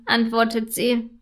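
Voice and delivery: ordinary delivery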